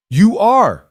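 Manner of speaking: sharp shout from the crowd. Acted. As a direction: yell it out accusingly. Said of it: "someone shouted from the back".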